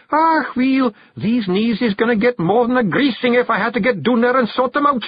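high, loud Scots threat; snarling. A narrator's reading. Hairy man threatening his own knees, high Scots snarl. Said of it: "snarled the hairy man".

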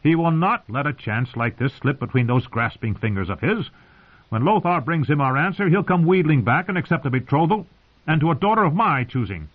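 indignant, insistent argument. A narrator's reading. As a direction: argue it stubbornly, pitch lifted. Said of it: "Edmure insisted".

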